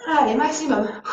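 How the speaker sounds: strained, talking mid push up; coaching while doing push ups. Real, not acted. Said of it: "ça commence à chauffer dans les dernières pompes". (en pleines pompes, voix d'effort)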